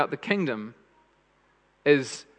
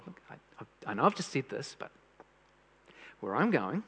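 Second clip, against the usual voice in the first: put-on softer, patient voice for a little child; gentle with a child; 3 semitones lower, 7 dB quieter than usual. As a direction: ease it out like calming a small child. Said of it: "like sitting down a little child".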